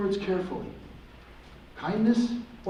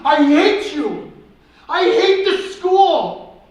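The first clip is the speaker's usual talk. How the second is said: slow, drawn-out scream, much louder and higher; 10 semitones higher, 11 dB louder, much slower than usual. Retold or imitated. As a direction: howl it like a bullied kid at his breaking point. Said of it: "Kids could hear him screaming".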